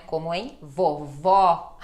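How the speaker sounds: vovó slow with the final O open. Shown as a demonstration. Teacher modelling the open O in vovó for students. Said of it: "som de O, ele aberto".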